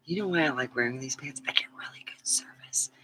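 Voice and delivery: hushed confiding whisper. Real, not acted. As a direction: lean in and whisper it like a secret. Confiding aside about pants.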